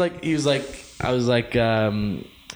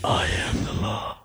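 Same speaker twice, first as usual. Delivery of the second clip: slow, softer breathy Batman rasp; low rasping growl; 5 semitones lower, 5 dB quieter, much slower than usual. Pretend. Cohost cutting in with a low slow Batman growl.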